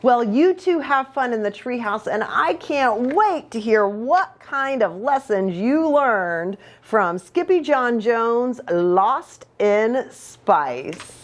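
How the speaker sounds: ordinary delivery, nothing marked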